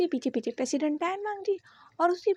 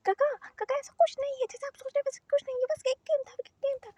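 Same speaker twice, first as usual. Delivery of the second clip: desperate pleading; 8 semitones higher, faster than usual. Acted. Wing desperately stops the fight.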